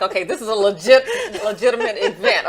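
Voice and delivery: loud, laughing gush of delight; excited laughing quote. Retold or imitated. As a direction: laugh through it, thrilled. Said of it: "And I'm like".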